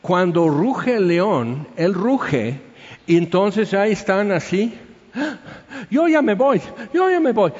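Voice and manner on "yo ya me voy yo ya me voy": high-pitched mock-panicked gazelle; pánico fingido. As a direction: blurt it high, as if about to bolt. Gacela asustada imitada que huye. (gacela asustada con voz aguda, fingida)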